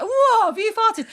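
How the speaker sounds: high, loud, drawn-out disbelief; slow, loud mock outrage with laughter behind it; retold exclamation. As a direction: imitate the appalled man's shout. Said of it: "honestly said that".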